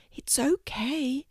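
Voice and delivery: whispered, slow reassurance; soft. A narrator's reading. Kangaroo soothing a frightened chick, soft whisper. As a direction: hush it gently so the bird stays calm. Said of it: "said Sylvia softly".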